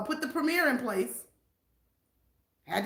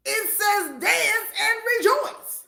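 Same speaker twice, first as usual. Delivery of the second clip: high, loud, slow proclamation; preaching; 8 semitones higher, 4 dB louder, slower than usual. Real, not acted. Preacher proclaiming the Bible says dance. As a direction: proclaim it from the pulpit.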